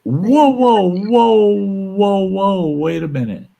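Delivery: high, loud stop-right-there whoas, settling down; loud indignant whoa. Real, not acted. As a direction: slam the brakes on the insult. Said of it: "condescending to you like that".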